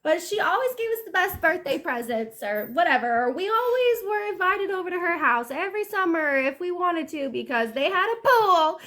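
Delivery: high-pitched, hammed-up fond reminiscence; gushing relative. Relative fondly remembering dear Aunt Sally, hammed-up gushing. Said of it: "They're gonna be like".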